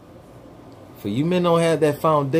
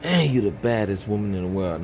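ordinary talk, nothing marked

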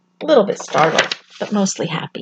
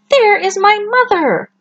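put-on excited baby bird; 12 semitones higher than usual. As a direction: call out as if you found her. Baby bird sees the big thing.

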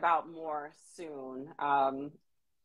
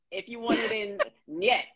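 laughing; 5 semitones higher than usual. Real, not acted. Joking about no in Russian.

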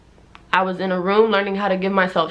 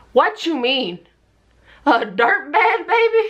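put-on climbing higher to a shocked baby; rising disbelief; 6 semitones higher, slower than usual. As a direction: drag it out slowly, then squeak. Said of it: "I was like".

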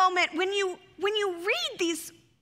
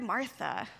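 soft, lower, gentle Martha; tender recognition; 6 semitones lower, 6 dB quieter than usual. Shown as a demonstration. Jesus recognising Martha, soft and tender. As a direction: say her name like greeting a loved friend. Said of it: "that recognition of a name".

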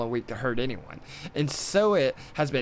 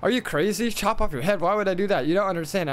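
arguing wife; 6 semitones higher than usual. Acted. Wife argues with tiger.

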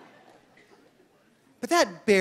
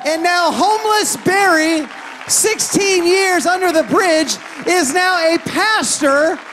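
preaching at full force; 6 semitones higher, 5 dB louder than usual. Acted. Pastor preaching Barry's transformation to the congregation, shouting. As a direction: build to a shouting climax.

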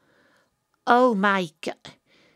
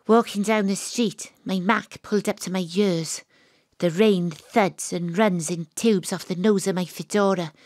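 low, steady hard-boiled narration; noir narration; 4 semitones lower than usual. A narrator's reading. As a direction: tell it like a rain-soaked detective story.

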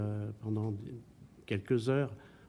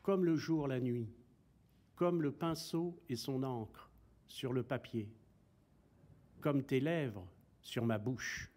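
measured, softened poetry reading with long pauses; poem recitation; 2 semitones higher, 4 dB quieter than usual. Acted. Poet reciting verses about light and figs, measured poem recitation. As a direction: recite line by line, pausing after each image.

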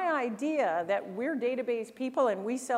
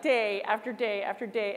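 usual speaking voice

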